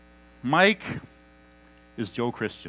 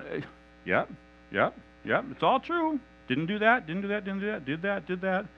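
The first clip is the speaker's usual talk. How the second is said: resigned agreement; 6 semitones higher than usual. Acted. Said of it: "[name]'s going".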